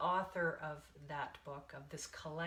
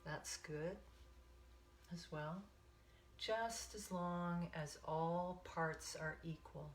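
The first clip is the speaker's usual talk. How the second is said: guided meditation. Acted. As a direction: ease them into the breath, one count at a time.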